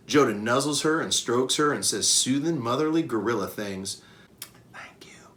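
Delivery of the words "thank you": mouthed in a breathless whisper. Acted. Mother gorilla mouthing thanks to Bob, whispered. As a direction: barely say it aloud. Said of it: "Joda mouths to me".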